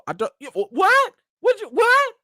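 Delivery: high, loud stuttering disbelief; mock-confused outburst; baffled. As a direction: stammer as if you caught nothing. Acts out failing to understand British speakers.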